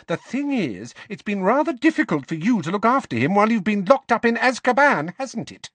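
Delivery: high, fast, cutting sarcasm; shrewish, high-pitched taunt; scornful. A narrator's reading. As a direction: say it with a curled lip, voice climbing. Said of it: "her lip curling".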